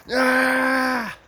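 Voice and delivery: sudden high, loud yell; short scream acting out chaos; mock scream. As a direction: yell out a burst of panic and noise. Mimics chaos and noise.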